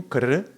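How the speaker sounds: rolled r crash noise; crashing krr. Shown as a demonstration. Showing things happening in a crash, trilled krr. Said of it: "There's things happening".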